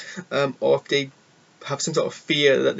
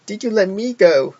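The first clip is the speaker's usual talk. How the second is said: higher, slow, small bear voice; Pooh's hurt question; 7 semitones higher, 5 dB louder than usual. Retold or imitated. Pooh asking Robin if he fired him, high and slow. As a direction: ask it like a wounded little bear. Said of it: "And Pooh's like".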